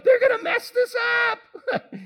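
high, loud laughing mock panic; mock fretting God. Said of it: "Is he there going".

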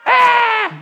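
high scream of fright at an angel; loud scream. Shown as a demonstration. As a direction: cry out in terror. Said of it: "what do people say when they see an angel".

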